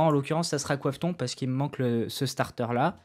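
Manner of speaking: normal talking voice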